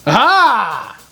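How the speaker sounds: high, loud aha of triumph; triumphant aha. Real, not acted. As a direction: celebrate the trick working. Avocado trick worked.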